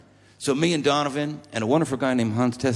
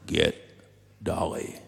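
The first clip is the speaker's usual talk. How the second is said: low, softer, lightly imitated sick man; ailing old friend; 7 semitones lower, 5 dB quieter, slower than usual. Dying banjo player repeats his request, lightly imitated, low and soft. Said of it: "Darrell started going downhill pretty quick".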